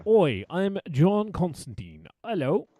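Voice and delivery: a bad British accent, like Keanu playing Constantine; mock British accent. Mocking Keanu's Constantine to friends, hammed-up British accent. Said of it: "Like that?".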